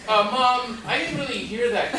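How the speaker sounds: a kid pretending not to hear his mom, giggling; embarrassed kid. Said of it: "So you're like".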